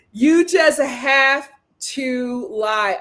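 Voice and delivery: every word up to "lie" stretched out; slow emphatic "lie". Real, not acted. Advising how to date men, each word to "lie" drawn out. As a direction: spell out "have to lie" very slowly.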